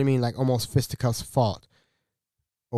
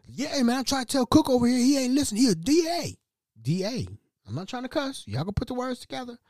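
high squeaky customer voice; mock customer complaint; 10 semitones higher than usual. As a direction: whine about the cook in a high put-on voice. Customer complains about the cook.

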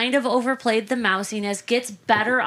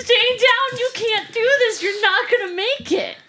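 desperate coach; 11 semitones higher, 4 dB louder than usual. Retold or imitated. Coach begging his fighter to quit, high and loud. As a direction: plead with the fighter to stay down. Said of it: "that coach that's like".

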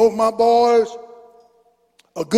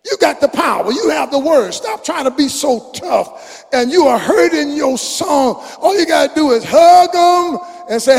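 raised, pleading preacher exhortation to fathers; fiery preaching; 5 semitones higher, 3 dB louder than usual. Acted. Preacher pleading with fathers to stop being tough, fiery preaching. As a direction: drive it home to the fathers, loud and urgent.

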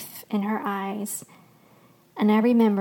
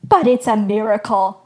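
loud, joyful proclamation; loud and awestruck; exuberant; 7 dB louder than usual. A narrator's reading. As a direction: burst out with the wonderful news. Grinning shepherd proclaims miracle.

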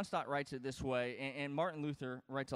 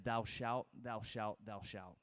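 "Thou shalt" said three times, low and dropping to much softer; commanding thou shalt; 6 semitones lower, 4 dB quieter, slower than usual. Shown as a demonstration. Pastor voicing God's law to Moses, low commanding thou shalt. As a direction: lay down each "thou shalt" lower, fading out. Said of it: "Do you hear the difference?".

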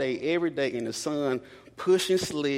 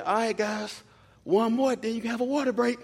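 coach calling out; 9 semitones higher than usual. Retold or imitated. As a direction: bark it like a coach on the field. Coach voiced giving orders.

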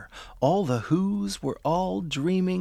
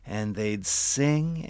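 soft and slow; 7 semitones lower, 6 dB quieter, slower than usual. A narrator's reading.

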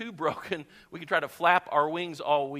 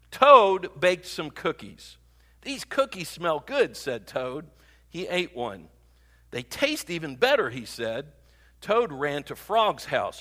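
measured storybook narration with lively character lines; animated read-aloud; children's story reading; 4 dB louder than usual. Acted. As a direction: read it like a picture book, phrase by phrase.

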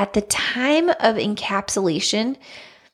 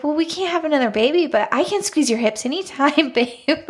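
lightly voiced husband, higher, breaking into a laugh; retold consoling husband; 6 semitones higher than usual. Husband offers his wife a consolation, retold and amused. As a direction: offer it higher, playfully reassuring. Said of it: "he's like".

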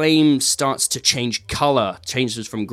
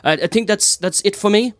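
high, flustered thank-you; a nervous entertainer wrapping up; shy performer; 7 semitones higher than usual. Acted. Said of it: "she's like".

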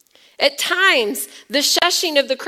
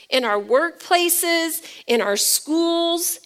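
ordinary talk, nothing marked

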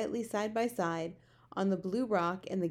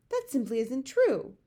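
higher, louder indignant denial; angry cry; 6 semitones higher, 6 dB louder than usual. A narrator's reading. Sophie defends human beings, crying angrily. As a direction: protest hotly, pitched up. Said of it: "Sophie cried angrily".

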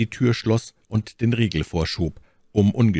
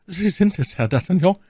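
high startled servant voice; alarmed, high-pitched recognition; frightened; 12 semitones higher than usual. A narrator's reading. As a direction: gasp it out high, taken aback with fright. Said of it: "sagte Grimaud erschrocken".

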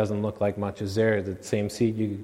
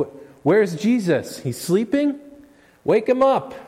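very high, urgent alarm, slow at first; rising panic searching for Jesus; frightened shout to wake the sleeper; panicked disciple; 12 semitones higher, 5 dB louder than usual. Retold or imitated. Panicked disciples on sinking boat.

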